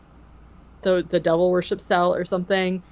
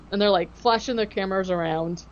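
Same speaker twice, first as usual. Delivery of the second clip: usual speaking voice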